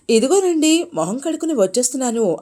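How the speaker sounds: hurried reply called across the yard; calling back from afar. A narrator's reading. Wife answering her husband from the well, calling out. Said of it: "Bhanumathi బావి దగ్గర నుంచి జవాబిచ్చింది".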